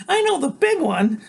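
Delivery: high, loud brassy woman. A narrator's reading. Loretta speaks.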